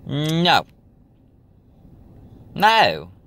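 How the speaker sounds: high, loud, falling "no"; a drawn-out, outright no to the price; emphatic refusal. Real, not acted.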